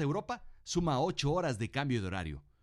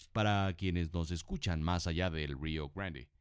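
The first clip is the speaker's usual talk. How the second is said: low aside voice; 8 semitones lower than usual. Acted. Same aside repeated.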